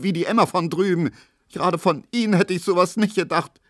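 high-pitched, wailing tirade; tearful indignation. A narrator's reading. Pauline accuses Emil of slander, tearfully indignant. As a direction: berate him in a high, upset voice.

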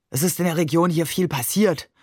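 mild weary groan in the voice; understated groaned lament. A narrator's reading. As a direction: say it with a touch of a groan. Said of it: "stöhnte er".

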